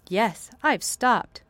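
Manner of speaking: put-on slow and proud, a boy's voice; lightly voiced proud boy, slow. Colin proud of standing.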